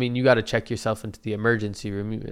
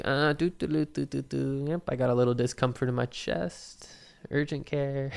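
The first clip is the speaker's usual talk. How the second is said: soft, idle singsong humming into speech; mock nonchalance; 3 semitones higher, 5 dB quieter than usual. Me just sitting there, mock carefree singsong. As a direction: act casually oblivious, quietly. Mimics himself idly waiting, singsong.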